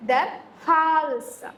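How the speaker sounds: ദർ ഹാൾസ് said slowly, each sound spelled out for students; teacher drawing out ദർ ഹാൾസ് very slowly; slow German demo. Shown as a demonstration. Teacher models German for neck to the class, slowly. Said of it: "നെക്കിന് എന്താ പറയുന്നത്?".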